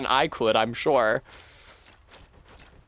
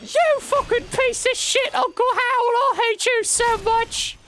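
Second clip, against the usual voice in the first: hammed-up shrieking teenage nephew; bratty nephew shriek; 3 dB louder than usual. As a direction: screech it like a furious kid. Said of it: "his nephew goes".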